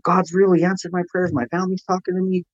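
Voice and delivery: mock shout of pure relief; retold screaming. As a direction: call out the good news, half-shouted. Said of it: "I was screaming in the pod".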